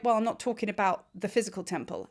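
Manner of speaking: normal talking voice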